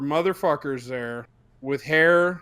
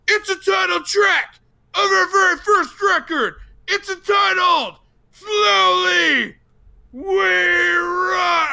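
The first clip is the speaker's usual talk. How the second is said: high, slow, strained stage scream; death metal singer; 12 semitones higher, 5 dB louder, slower than usual. Retold or imitated. Singer screaming the song title to the crowd. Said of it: "the singer's like".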